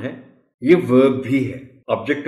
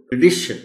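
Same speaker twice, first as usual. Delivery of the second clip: Tradition as truh-DI-shun, higher and louder; tradition with weak first vowel, strong second syllable; stress on D; 4 semitones higher, 6 dB louder, faster than usual. Shown as a demonstration. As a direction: punch DI in tradition. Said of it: "एक दफा फिर इसको बोल".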